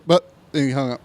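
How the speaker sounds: ordinary talk, nothing marked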